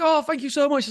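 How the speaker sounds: high-pitched gushing thank-you; a high, effusive mock thanks. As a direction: jump way up in pitch, over the top. Mock grateful self-quote.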